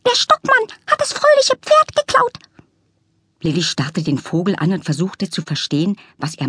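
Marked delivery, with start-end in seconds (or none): 0.1-2.4 very high, worried little peep; peeping bird. A narrator's reading. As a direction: squeak it like a scared bird.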